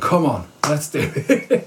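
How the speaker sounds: rally yourself back into running; a slow, psyched-up self pep talk; geeing himself up. Retold or imitated. Gee himself up to restart.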